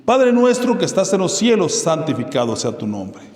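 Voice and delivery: opening line high and loud, then settling; solemn recitation. Acted. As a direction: intone the prayer like an invocation. Recites the Lord's Prayer.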